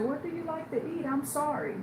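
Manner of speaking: high, timid, apologetic guard; mock-frightened apology; scared guard. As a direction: squeak it out like a nervous little guard. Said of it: "she was so scared".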